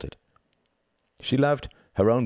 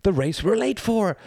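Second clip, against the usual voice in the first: high, urgent exclamation on the run; panted urgency; 13 semitones higher, 4 dB louder than usual. A narrator's reading. Badger answering Mouse on the run, high urgent cry. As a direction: call it out high while hurrying. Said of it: "panted Mister Badger".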